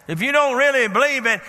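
ordinary talk, nothing marked

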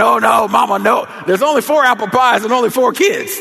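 put-on high, loud kids' objection; mock protest. Kids counting pies as Mama offers one, loud mock protest. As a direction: protest like outraged kids, hammed-up. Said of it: "And we were saying".

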